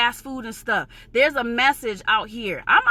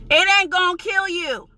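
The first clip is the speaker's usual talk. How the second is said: ordinary talk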